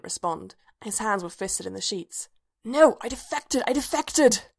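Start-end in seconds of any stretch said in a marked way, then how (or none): 2.6-4.4 higher, louder hissed protest; urgent insistence from inside a bad dream. A narrator's reading. Bodhi pleading in a nightmare, hissed. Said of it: "he hissed".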